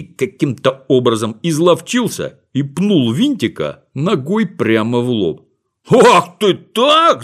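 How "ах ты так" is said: slow, high furious yell; angry shout. Acted. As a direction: drag out each word in rage. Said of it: "закричал разозлившись Винтик". (гневный крик)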